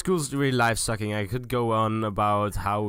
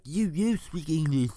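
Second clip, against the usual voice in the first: imitating French skiers; 5 semitones higher than usual. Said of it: "pointing at each other, like".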